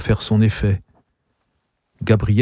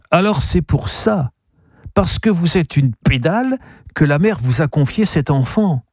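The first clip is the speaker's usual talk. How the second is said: raised, insinuating man's voice; the type accusing Gabriel, sly and raised; suspicious interrogator; 7 semitones higher than usual. A narrator's reading. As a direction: needle him with a sly accusation.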